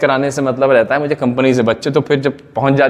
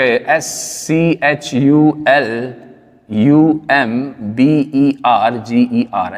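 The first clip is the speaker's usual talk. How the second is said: very slow, letter by letter; spelling aloud; 2 semitones lower, much slower than usual. Real, not acted. Teacher recalling a company's spelling for students, slowly spelling it out. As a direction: draw out each letter separately as you recall the spelling.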